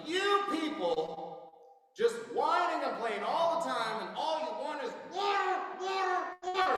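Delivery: high, slow, loud furious yelling; furious rant. Retold or imitated. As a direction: scream it at the whining crowd. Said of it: "and he's like".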